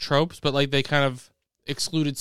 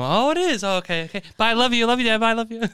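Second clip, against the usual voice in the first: higher and louder, eager rush of goodbyes; excited Buddy; 8 semitones higher, 4 dB louder than usual. Acted. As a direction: gush your goodbyes like an overgrown kid. Acting out Buddy on phone.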